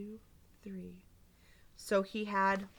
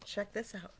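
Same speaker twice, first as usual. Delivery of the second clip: ordinary delivery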